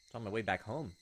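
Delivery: usual speaking voice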